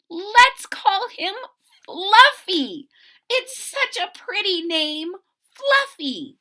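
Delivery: put-on high, loud, delighted naming of the baby; delighted parents. Parents proudly naming their baby, delighted and loud. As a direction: announce the name like proud parents.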